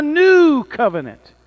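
new covenant drawn out, high and loud; emphatic climax. Acted. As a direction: lift and stretch new covenant. Climax of scripture reading.

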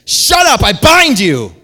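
high, very loud shouted command; full-force acted shout; forceful rebuke. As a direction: shout down the storm. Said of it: "that's actually not what he said".

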